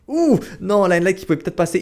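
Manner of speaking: caster crying out at a miss; excited dismay. Real, not acted. Caster reacts to a missed move.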